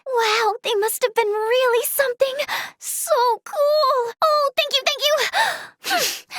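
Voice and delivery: high, gushing excitement; thrilled. Acted. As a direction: gush with delight, pitch soaring. Thrilled by news, gushes thanks.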